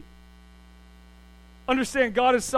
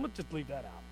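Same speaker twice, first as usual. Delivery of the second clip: soft, low conspiratorial aside; a hint of embarrassed Peter; mock hush; 6 semitones lower, 12 dB quieter than usual. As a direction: quietly ask to skip it. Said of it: "Peter said".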